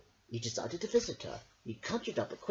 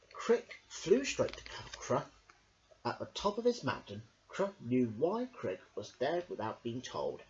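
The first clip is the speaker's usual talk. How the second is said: usual speaking voice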